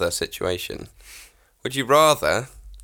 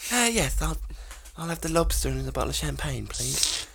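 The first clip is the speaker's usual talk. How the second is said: lightly voiced lady diner; 5 semitones higher, 7 dB quieter than usual. Posh diner ordering in restaurant.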